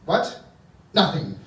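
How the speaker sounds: ordinary delivery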